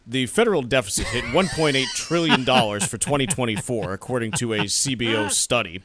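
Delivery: booming vintage newsreader announcing; old newscaster voice. Pretend. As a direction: project it like a fifties anchorman. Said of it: "We could do the whole segment that way".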